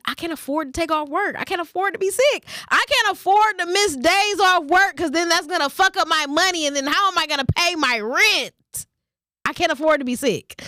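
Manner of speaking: high, whiny mock of a broke worker; mocking worried worker. Vlogger mocking workers afraid to miss pay, high whiny mock. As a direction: whine it up high like a stressed worker.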